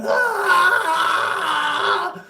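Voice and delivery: high mock scream of pain; imitated scream. Electrician burnt by lime, a high mock scream. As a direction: shriek it like he's been burnt. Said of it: "this screaming and crying".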